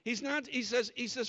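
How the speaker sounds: ordinary delivery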